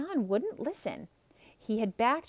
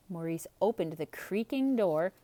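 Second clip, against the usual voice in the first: ordinary delivery, nothing marked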